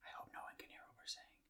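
hushed, quick whisper. Real, not acted. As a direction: whisper it like a secret aside. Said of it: "Why are we whispering?".

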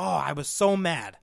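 ordinary talk

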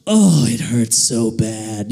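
low, slow comic moan of pain; drawn-out mock agony; foot's noise. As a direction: groan it out slowly as an injured foot. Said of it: "It went a little something like".

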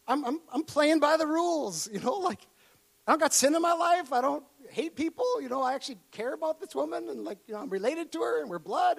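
high, whiny, pleading questions; wounded confusion. Retold or imitated. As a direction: plead your innocence, hurt.

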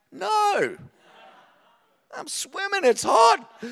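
put-on very high, loud childish protest; kid yelling back. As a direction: holler back at mum as a defiant kid. Child yelling back at his mum.

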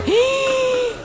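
sharp high gasp of shock. Retold or imitated. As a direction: gasp as if hearing the betrayal. Retelling shock at gossip. (grito ahogado de sorpresa, muy agudo)

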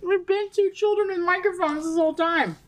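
laughing. Real, not acted. Laughing agreement.

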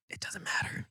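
very soft, voiceless dismissal; hushed peer pressure; whispered brush-off. Retold or imitated. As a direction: wave the worry away in a whisper. Quietly brushing off friend's worry.

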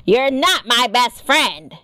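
gruff, surly retort. A narrator's reading. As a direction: rebuff a would-be best friend. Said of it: "came the gruff voice".

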